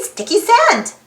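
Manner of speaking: usual speaking voice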